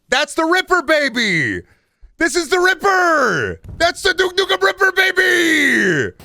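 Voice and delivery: very high, loud drawn-out hype yell; hyped yelling. Real, not acted. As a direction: scream it in wild excitement, stretching the ends. Hyped yelling at finding the Ripper.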